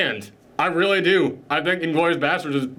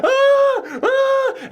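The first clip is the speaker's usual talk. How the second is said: very high, loud imitated shriek; two high cartoonish screams; mock scream; 4 dB louder than usual. Woman with teeth knocked out, high mock screams.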